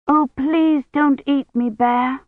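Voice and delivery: high, pleading storybook maiden. A narrator's reading. Maiden begs the bear not to eat her, pleading. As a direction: beg in a high girlish voice.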